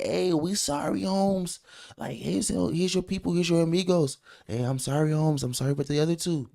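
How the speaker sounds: high, hammed-up mock street accent, apologetic. Host mocking the cartel's apology letter in a high mock accent. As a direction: pitch it way up in a jokey mock accent.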